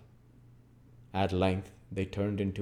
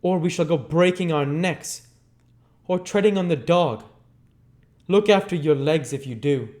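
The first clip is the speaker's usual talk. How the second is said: loud, high gruff demand; Sikes's loud voice; 9 semitones higher, 7 dB louder than usual. Acted. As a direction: bark for a light.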